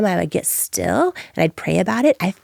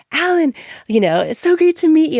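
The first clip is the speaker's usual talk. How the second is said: high, louder, overeager; nervous, much higher and louder; gushing eager greeting; 11 semitones higher, 4 dB louder than usual. Retold or imitated. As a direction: sound thrilled to finally talk. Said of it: "I'm like".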